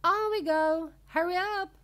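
very high, loud rallying cry; urgent call. A narrator's reading. Wonka hurrying the visitors along, a high, loud call. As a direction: urge everyone on, calling out high. Wonka urging the group onward.

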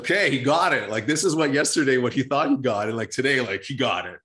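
excited realization. Retold or imitated. S1 retells own excited thought.

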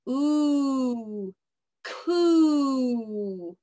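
"ooh" blended into "coo"; sounding out. Shown as a demonstration. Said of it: "Let's read".

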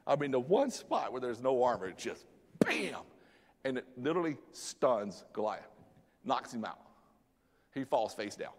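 usual speaking voice

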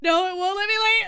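high, loud panicked yell; panicked shout. Real, not acted. Trapped, panicking.

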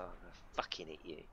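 lightly voiced low grumbling worker; low mock threat. Voicing an annoyed game builder, low mock threat. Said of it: "he's like".

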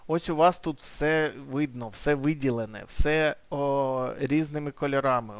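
ordinary talk, nothing marked

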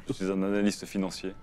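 grave, lent et bien plus doux; voix sombre qui se veut séduisante; dark brooding voice. Acted. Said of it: "avec ta voix ténébreuse". (voix ténébreuse)

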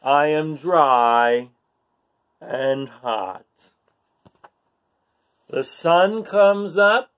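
slow, expressive storybook reading; reading to children. A narrator's reading. Storyteller reads to kids, slow and expressive. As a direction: paint each line for little listeners.

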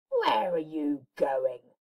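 low slow growl; wolf voice. Acted. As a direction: growl it like a big bad wolf. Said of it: "he growled".

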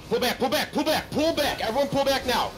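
high, loud commanding yell; urgent shout. Real, not acted. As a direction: order the retreat at full voice. Ordering team retreat.

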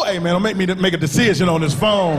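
ordinary talk, nothing marked

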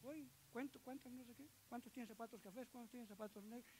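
quiet, almost murmured listing of counts; quoting Rafita softly. Retold or imitated. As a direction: hush it like he counts to himself. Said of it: "Y él dice". (citando a Rafita en voz baja, contando para sí)

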